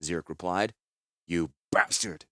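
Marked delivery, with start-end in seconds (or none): 1.2-2.2 low, contained anger; spat in anger. A narrator's reading. Commander cursing the man who broke orders, low spat anger. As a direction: spit out the insult, pitched down. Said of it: "Gerard spat".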